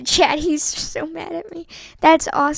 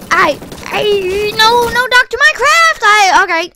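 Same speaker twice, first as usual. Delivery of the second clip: loud protest; 4 semitones higher, 9 dB louder than usual. Real, not acted. Gamer pleading with Doctor Minecraft in a match, loud protest. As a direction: voice climbing high and loud.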